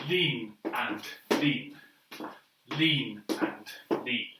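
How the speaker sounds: slow, drawn-out dance calls; slow called steps. Shown as a demonstration. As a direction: call the steps slowly to the beat. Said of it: "we can do it together".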